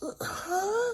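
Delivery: high confused huh; baffled. Real, not acted. Narrator baffled by the panda greeting, high. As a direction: trail into confusion.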